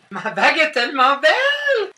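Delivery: very high, loud squeal of delight; exaggerated gushing. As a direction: gush over it, hammed-up. Mimicking the rival in scorn. (extase criarde et exagérée)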